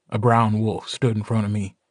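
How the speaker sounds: ordinary delivery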